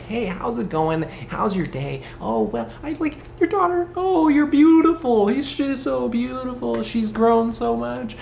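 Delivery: high, sugary fake-polite chatter; mock sweet small talk. INFJ faking friendly small talk to a neighbor, sugary and high. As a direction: gush like a people-pleaser making nice. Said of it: "acting all respectful".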